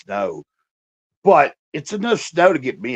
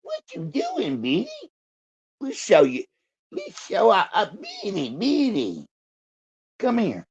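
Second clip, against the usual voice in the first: calling the dog; 8 semitones higher than usual. Real, not acted. Owner calling his shy dog over, high coaxing voice. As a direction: coax her out, pitched way up.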